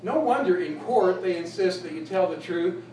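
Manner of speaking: ordinary talk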